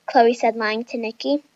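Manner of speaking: ordinary delivery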